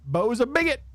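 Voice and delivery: lightly voiced rodent kid pitching a deal; Sid voice. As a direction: play an eager young rodent hustler. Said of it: "I like voicing Sid".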